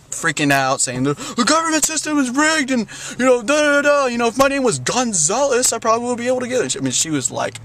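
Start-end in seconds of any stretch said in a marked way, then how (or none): 1.0-6.8 much higher, heated mock rant; mother ranting. Mother at the Social Security clerk, higher mock rant. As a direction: imitate a furious mom railing at the system. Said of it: "flipped out".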